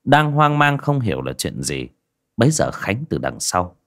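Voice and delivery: ordinary delivery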